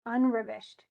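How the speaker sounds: unravished with UN stressed and the rest reduced; wrong stress on UN. Shown as a demonstration. Teacher mimicking a puzzled listener, unravished with stress on UN. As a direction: hit UN first in unravished. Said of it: "'Cause it's not on un".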